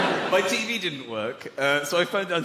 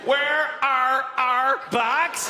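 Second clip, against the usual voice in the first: put-on higher, very slow Northern Irish accent; slow Northern Irish accent with rising end; 6 semitones higher, much slower than usual. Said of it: "get au fait with the accent".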